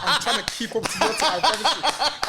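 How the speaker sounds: laughing hard while answering the story; real laughter. Real, not acted. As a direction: giggle helplessly while speaking. Friend cracking up at the story.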